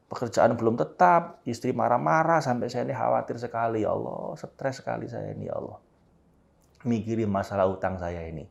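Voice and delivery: fading to a soft murmur; heartfelt prayer. Shown as a demonstration. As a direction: confess worries softly to God. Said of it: "dengan sepenuh hati". (doa curhat yang makin lirih)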